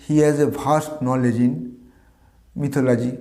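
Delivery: the example read out slowly, word by word; slow dictation. Shown as a demonstration. Teacher reading an example sentence, slow dictation. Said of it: "As a sentence".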